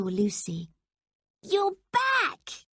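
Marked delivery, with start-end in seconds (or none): 1.4-2.7 high excited shout; boy amazed his friend is back, excited shout; exclaiming. A narrator's reading. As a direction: burst out in delighted surprise. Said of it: "he exclaimed".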